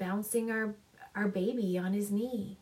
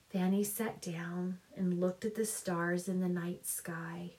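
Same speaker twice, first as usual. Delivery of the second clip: ordinary delivery, nothing marked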